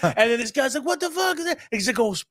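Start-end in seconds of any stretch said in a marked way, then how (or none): 0.8-1.6 high-pitched outraged quote. Retold or imitated. The robber seeing the gun, high and outraged. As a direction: squeal it in disbelief. Voicing the gunman.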